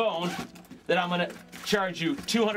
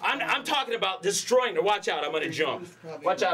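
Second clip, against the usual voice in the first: normal talking voice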